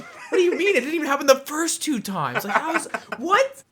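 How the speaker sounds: high, laughing disbelief; incredulous high laugh. Retold or imitated.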